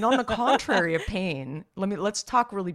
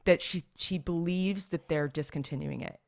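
ordinary talk, nothing marked

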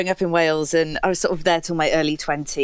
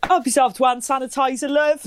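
higher, cheerful local doorman; chirpy Welsh bloke offering sanitizer; lightly voiced friendly Welsh doorman; 8 semitones higher than usual. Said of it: "they're like".

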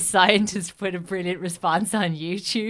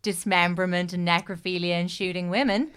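ordinary talk, nothing marked

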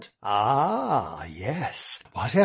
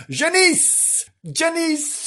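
much higher sing-song call; high sing-song name; much slower than usual. Acted. Host introducing roving reporter Janice, high sing-song. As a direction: trill the name like a fanfare.

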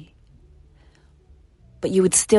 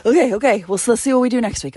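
flustered self pep talk; 7 semitones higher, 6 dB louder, faster than usual. Acted. Pep talk to herself mid-marathon.

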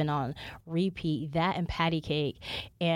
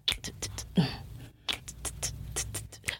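beatbox; 2 semitones lower, 5 dB quieter, faster than usual. Acted. Beatbox sounds before music talk.

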